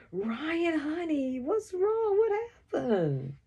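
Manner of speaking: high-pitched worried questioning; panicked mother. A narrator's reading. Mom at the door to crying child, panicked. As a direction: ask in a high, alarmed voice. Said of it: "with panic in her eyes".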